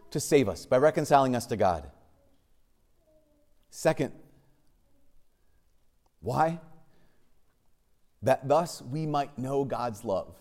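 usual speaking voice

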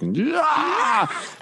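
high wordless yell of panic; anguished shout. Retold or imitated. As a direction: let out a sudden high cry. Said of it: "every part of my body is like".

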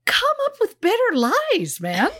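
high-pitched, incredulous scolding; exasperated mock plea. Host scolding the absent suspect over his lies, exasperated.

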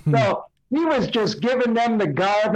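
ordinary talk

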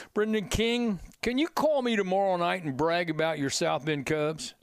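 normal talking voice

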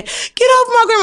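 high yelled plea; retold scream. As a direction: shriek it like a scared kid. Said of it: "I'm screaming".

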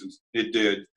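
ordinary delivery

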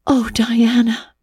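a hushed, awed whisper to a friend; breathed. A narrator's reading. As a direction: breathe it out in wonder. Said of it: "breathed Anne".

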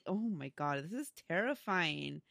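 normal talking voice

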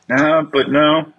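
slow, drawn-out actorly refusal; mock Bradley Cooper. Bradley Cooper refusing, slowed mock insistence. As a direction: insist slowly like a serious actor. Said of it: "But Bradley Cooper's like".

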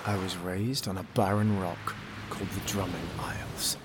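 grand, swelling storyteller voice; epic narration. A narrator's reading. Narrator tells his people's history, epic and proud. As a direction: tell the legend proudly.

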